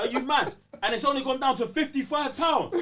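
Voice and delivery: high, loud cry of disbelief; outraged outburst. Real, not acted. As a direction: shout it like the price is an insult. Outraged at the game's price.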